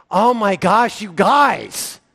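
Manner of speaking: exasperated groan at the group; frustrated. Acted. Jesus as imagined upset with dunderhead disciples, frustrated. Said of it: "I'd be so upset and frustrated".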